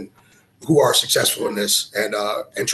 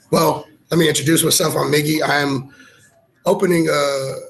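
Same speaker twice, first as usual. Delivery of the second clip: ordinary talk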